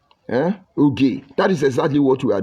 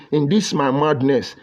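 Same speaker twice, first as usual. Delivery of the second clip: ordinary delivery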